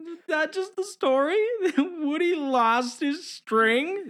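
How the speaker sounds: very high, drawn-out mock disbelief; squeaky cowgirl impression, slow on the last words; Jessie. Blooper where she questions the story, high exaggerated voice. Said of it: "she has a great delivery on that".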